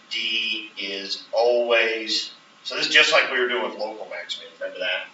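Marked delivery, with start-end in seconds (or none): 0.1-2.3 very slow, "always" drawn out long; emphasis on always. Shown as a demonstration. Teacher dictating, slowly stressing "always". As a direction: hold "always" and let it ring. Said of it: "the emphasis on the word always".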